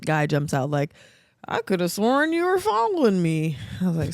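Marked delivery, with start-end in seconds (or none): 1.5-3.6 hammed-up impression, pitch swooping high; imitating a stranger. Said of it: "Was he really talking like that?".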